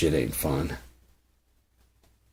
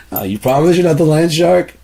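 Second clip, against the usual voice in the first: high loud suspicious woman; nervous woman at door; 11 semitones higher, 9 dB louder than usual. Acted. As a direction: ask it like a wary woman at the door.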